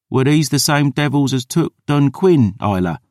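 lightly voiced boy; wary lad. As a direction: ask like a nervous youth. Boy's voice.

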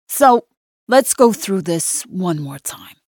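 drawn-out New York cop; detective's New York drawl, lightly voiced. A narrator's reading. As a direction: question a suspect in a slow New York drawl. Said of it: "says in his New York drawl".

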